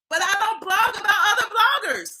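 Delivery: loud, high mock declaration; mocking mimicry. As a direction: parrot her claim loudly and high. Mimicking the woman's claim.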